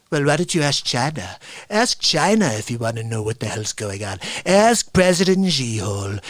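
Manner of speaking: low-pitched impression of an irritated president; Donald Trump. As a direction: scold a reporter in a deep put-on voice. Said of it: "he's yelling at this lady".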